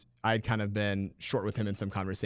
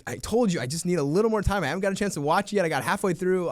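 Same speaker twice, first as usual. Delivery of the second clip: defensive excuse; 7 semitones higher, 4 dB louder than usual. Retold or imitated.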